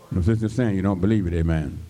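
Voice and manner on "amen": ordinary delivery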